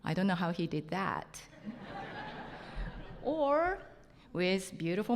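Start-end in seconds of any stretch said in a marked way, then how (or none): 1.7-3.2 breathy high-pitched giggle; little airy laugh. Real, not acted. Laughs at her own joke.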